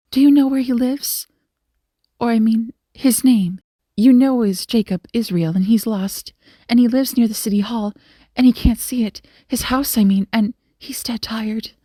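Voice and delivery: lightly voiced anxious young woman; flustered young lady. A narrator's reading. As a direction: tumble through it, correcting yourself. Flustered young woman asks for help.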